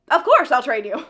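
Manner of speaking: loud, emphatic retold yes; mock eager agreement. Cassian agreeing to train her, mock eager agreement. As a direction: declare it loudly, imitating a keen trainer.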